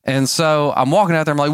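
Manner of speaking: ordinary delivery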